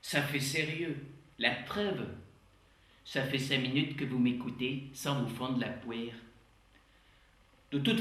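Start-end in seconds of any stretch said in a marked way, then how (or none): 0.0-6.1 lecturer with a Québécois twang throughout; Québécois accent, comic conference host; put-on Québécois accent. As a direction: play a Quebec showman professor. J'ai pris cet accent.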